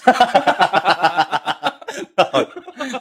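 a long, high burst of real laughter; helpless laugh. Real, not acted. Teller cracks up at his friend's joke, real laughter. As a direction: laugh it out, caught off guard.

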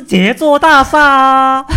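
high, lilting Cantonese Mandarin; Cantonese accent in Mandarin. Retold or imitated. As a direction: say it like a Cantonese speaker. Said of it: "广东人他那个普通话说的不好".

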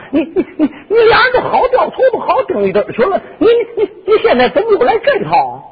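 high, fast, tearful clown protest; clown mock wailing. Clown Hu Ying whines at the princess, mock tearful.

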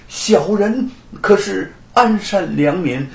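slow, uneasy pleading on 安善良民; anxious, drawn-out protest of innocence 安善良民; a touch of nervous pleading; uneasy plea. A narrator's reading. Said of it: "罗万金不安地说".

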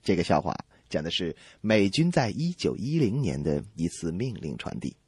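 ordinary talk, nothing marked

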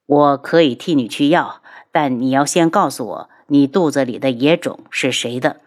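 low, controlled accusation; cold confrontation. Acted. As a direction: drop the voice and demand an answer. Cold answer in the quarrel.

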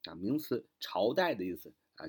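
ordinary delivery

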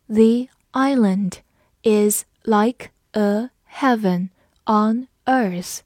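slow, word by word; slow read-along. Shown as a demonstration. Teacher reading slowly for the class to repeat. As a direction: read it slowly for students to follow. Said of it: "跟着我慢读一遍".

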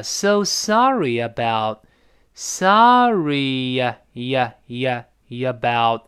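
slow "sorry呀about" with a y-glide between words; linking sorry-about. Shown as a demonstration. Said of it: "得用e来过渡一下". ("sorry"和"about"用"呀"连读)

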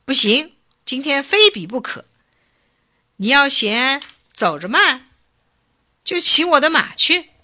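high, urgent insisting; anxious insistence. A narrator's reading. Chief offers his horse to stop delays, impatient. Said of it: "头人急着说".

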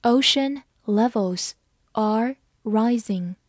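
slow, careful model reading; read slowly. Shown as a demonstration. Teacher reading slowly so students can follow. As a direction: lay each word down slowly. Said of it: "好，慢慢来读".

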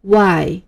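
"Y" said clearly as wai; letter demo. Shown as a demonstration. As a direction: model "Y" for the class. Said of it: "我们再来读一次Y".

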